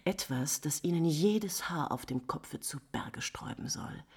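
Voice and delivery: hushed, secretive whisper; low conspiratorial whisper. Acted. Countess confides a secret.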